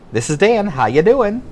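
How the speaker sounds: put-on cheerful small-town kid answering the phone; Michigan phone greeting. Michigan kid answering the phone, folksy greeting. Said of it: "We'd say".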